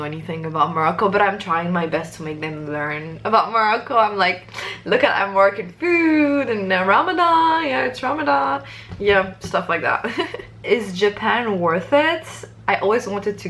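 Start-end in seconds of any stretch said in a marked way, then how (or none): 4.8-8.6 high, louder show-and-tell voice; hammed-up enthusiasm. Teaching Japanese people about Morocco, hammed-up eager pitch. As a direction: playfully sell it, pitch climbing high.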